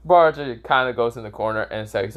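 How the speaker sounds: usual speaking voice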